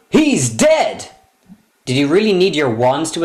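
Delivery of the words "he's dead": 1 mock shocked. Finding the creature dead.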